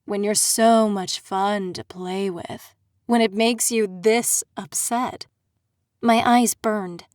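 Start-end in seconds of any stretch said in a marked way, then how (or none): 0.0-5.3 slower, darker, smug villain voice; a smug aristocrat savoring his mockery; Aldrin, smug fae prince. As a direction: purr it out like a gloating prince.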